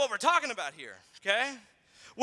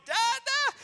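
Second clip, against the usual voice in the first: put-on much higher baby call of Dada; dad imitating his baby son; toddler call; 13 semitones higher than usual. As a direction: sing out like a toddler summoning dad. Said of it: "He calls me".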